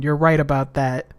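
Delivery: ordinary talk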